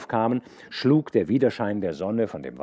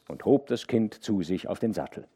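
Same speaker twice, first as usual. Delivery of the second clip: usual speaking voice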